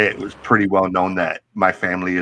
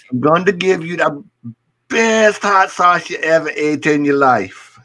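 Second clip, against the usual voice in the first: put-on Jamaican accent; 7 semitones higher than usual. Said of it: "He's like".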